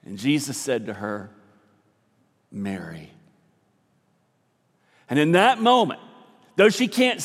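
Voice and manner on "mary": tender. Acted. Jesus tenderly says her name.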